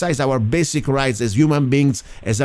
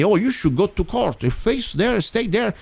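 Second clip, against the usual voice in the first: high, mocking singsong of critics; mocking quote; 8 semitones higher than usual. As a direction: parody their smug advice in a high voice. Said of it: "and then they tell me".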